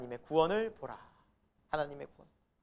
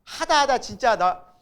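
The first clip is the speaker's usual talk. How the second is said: loud, raised voice mocking indignation; indignant brothers; 4 semitones higher, 8 dB louder than usual. Brothers to Joseph about his dream, acted indignation. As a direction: play the fed-up older brothers. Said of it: "막 열받는 거예요".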